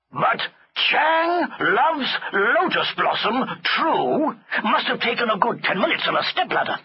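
high, drawn-out exasperated retort; slow, incredulous angry master; irate elephant master. A narrator's reading. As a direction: spell out the offending slogan indignantly.

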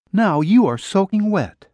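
lightly voiced young woman teasing; her voice. Woman's lines voiced higher.

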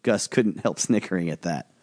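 ordinary delivery

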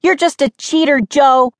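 high, indignant accusation; angry shout. A narrator's reading. Sister accusing sibling of cheating, shouting angrily. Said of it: "Mindy shouted angrily".

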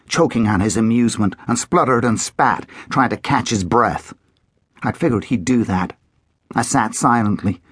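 ordinary delivery

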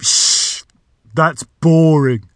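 loud shush, then slow dismissal; interrupting door. A narrator's reading. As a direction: cut in with a shush, then drag out the complaint. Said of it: "the voice interrupted".

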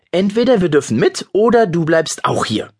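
put-on boy threatening his cousin. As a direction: push him into a corner. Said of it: "drohte Yannick".

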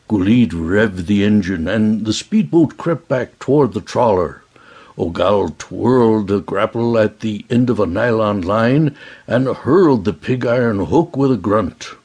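plain reading voice

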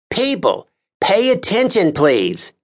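high, commanding call to the room; officious Lars Kern. A narrator's reading. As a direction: call the jurors to order. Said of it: "He paused".

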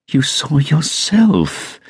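high, drawn-out near plea; almost pleading. A narrator's reading. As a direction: stretch it out high, as if begging to be believed. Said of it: "she said, almost pleading".

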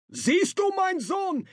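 a soft high call from afar; distant voice. Acted. A voice from the other boat speaks to his son, high and soft.

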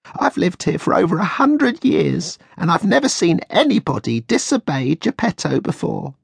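high, odd tiny voice of an ancient insect; lecturing the naughty puppet; old cricket. A narrator's reading.

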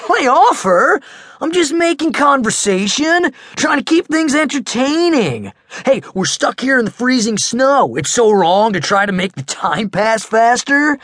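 high, loud protesting; defensive big kid. Acted. Max defensive.

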